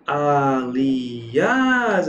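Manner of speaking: Aliás stretched, with a long stressed "ás"; Aliás slowly sounded out, weight on "ás"; stress on the final syllable. Shown as a demonstration. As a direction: draw Aliás out syllable by syllable, holding the end. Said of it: "a sílaba tônica é essa".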